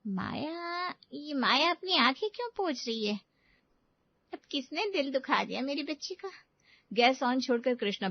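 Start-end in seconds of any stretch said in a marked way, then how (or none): 0.1-6.3 higher-pitched, worried mother; puzzled, protective mother; motherly concern. Acted. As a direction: call out in concern for your child. Mother spots daughter wiping her eyes.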